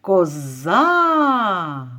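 коза with a long, falling final А; calling, vowel stretched. Shown as a demonstration. Teacher shows which vowel stretches, calling коза with long final А. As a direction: call коза and hold the last vowel. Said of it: "Який тягнеться?".

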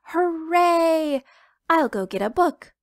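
high, loud hooray from a little chicken; cheering chick. Acted. Little chicken cheering that Papa will read, loud and high. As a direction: burst out cheering like a tiny chick.

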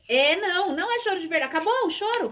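high-pitched playful coaxing. Real, not acted. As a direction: tease a little one out of crying, voice way up. Mother teasing child about fake crying. (brincando com a criança, voz bem aguda)

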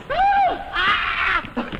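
high, loud theatrical ah of passion; passionate cry. Acted. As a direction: fling out a hammed-up ah. Passionate cry mid-declaration.

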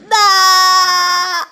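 high, loud anguished yell; shouted no. Real, not acted. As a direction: shout it at the screen. World ends in the game.

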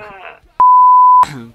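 loud burst of laughter. Real, not acted. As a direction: roar with laughter.

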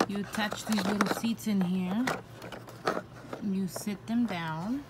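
ordinary delivery

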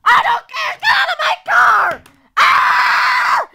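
very high screaming tantrum, played; mommy's road rage. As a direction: shriek like a mother losing it in traffic. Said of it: "Mommy's scary when she's in road rage".

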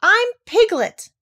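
loud, very high protest; high, desperate little cry. A narrator's reading. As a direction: yell to be let out. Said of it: "cried Piglet".

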